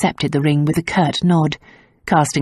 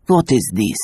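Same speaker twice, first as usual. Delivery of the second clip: low, much deeper stern demand; gendarme demanding; 7 semitones lower than usual. A narrator's reading. Gendarme confronting the couple, low stern demand. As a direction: demand it like a suspicious policeman. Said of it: "he demanded".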